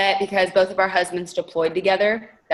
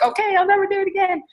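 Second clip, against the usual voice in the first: much higher, fast, crying-like mock promise; meek high promise; 12 semitones higher, faster than usual. To husband, quickly promising in a high mock whimper. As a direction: squeak it out like a scolded kid.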